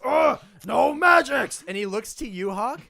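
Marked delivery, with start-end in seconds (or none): 0.0-1.6 loud, high, slow shout; angry orc shout. Acted. Orc shaman shouts in pain as his hair gets pulled, loud and slow. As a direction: bark it out as your hair is yanked. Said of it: "He goes".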